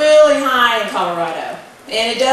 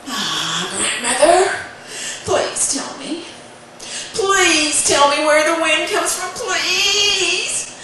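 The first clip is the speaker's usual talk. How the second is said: put-on higher, laughing wheedling child; pleading boy; 5 semitones higher, slower than usual. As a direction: wheedle sweetly for the secret.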